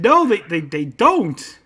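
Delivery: loud, high retold protest; exasperated contradiction. Son correcting his dad about olives, exasperated. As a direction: argue back, hammering the denial.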